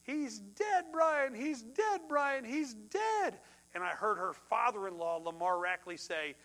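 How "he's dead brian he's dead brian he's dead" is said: high, anguished cry of he's dead; voicing a wife's panicked scream; retold frantic scream. Preacher retelling a widow's scream down the phone, high and anguished. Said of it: "I heard her scream into the phone".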